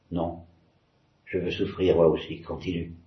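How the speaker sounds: a quiet, pale no; barely voiced no; soft. A narrator's reading. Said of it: "proféra doucement Alyosha". (doucement, à peine audible)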